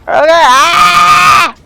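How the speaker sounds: long, high, loud yell of panic; high-pitched "aah" at full voice; panicked scream. Real, not acted. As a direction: scream as danger closes in. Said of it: "I'm panicking!".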